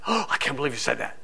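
mock shocked gasp. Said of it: "They want to see you shocked".

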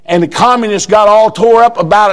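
ordinary talk

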